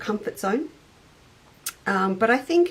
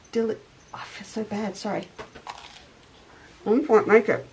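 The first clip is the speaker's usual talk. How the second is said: talking through a mouthful of toast; words round a bite of raisin toast; mouth full. Real, not acted. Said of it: "Don't talk with your mouth full".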